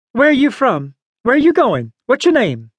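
high-pitched rapid-fire questioning; interrogating old lady. A narrator's reading. Old lady fires questions at boy.

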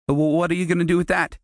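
put-on scared boy cringing at the bucket. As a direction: blurt it out in panic. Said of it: "Nate cringed".

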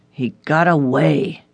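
slow, groaning words; a frustrated groan from the detective. Acted. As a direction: let the words sag out in a groan. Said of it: "Ulrich groaned".

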